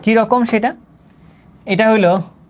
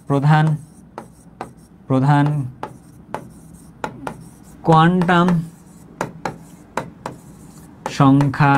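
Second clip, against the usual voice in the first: word by word with long pauses; dictation; 3 semitones lower, slower than usual. Shown as a demonstration. Teacher writing out what N denotes, halting word-by-word dictation. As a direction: dole out each word as it is written.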